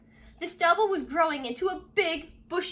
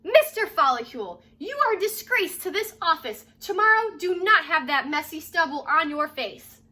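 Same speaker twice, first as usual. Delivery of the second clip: loud, stern scolding boss; rather loud voice; 3 semitones higher, 5 dB louder than usual. Acted. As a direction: bellow the reprimand. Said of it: "said in a rather loud voice".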